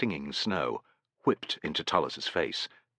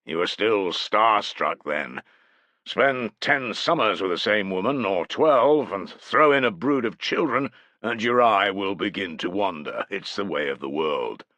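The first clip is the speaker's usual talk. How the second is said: put-on hearty older warrior, louder and higher; bluff chieftain voice; 4 semitones higher, 6 dB louder than usual. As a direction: boom it out like a lusty chieftain. Malavendus giving worldly advice.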